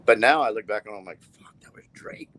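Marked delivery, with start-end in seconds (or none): 1.2-2.3 whispered and high; breathy hushed realization. Retold or imitated. Realizing in hindsight.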